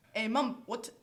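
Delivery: loud mock-Scottish call; put-on Scottish shout; Scottish accent yell. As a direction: holler for mum in a Scottish accent. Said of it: "screamed at her in a Scottish accent".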